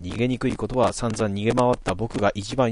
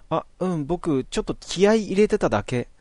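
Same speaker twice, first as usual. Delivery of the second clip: put-on higher, sheepish boy's excuse; sheepish Natsumi; 8 semitones higher than usual. Natsumi making an awkward excuse to Tooru, higher and sheepish. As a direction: shrug it off a little embarrassed.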